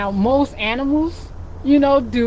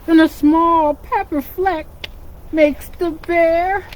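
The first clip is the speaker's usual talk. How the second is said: lively storybook reading for little ones; animated picture-book narration; reading to children; 4 semitones higher than usual. A narrator's reading. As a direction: read it like storytime with kids.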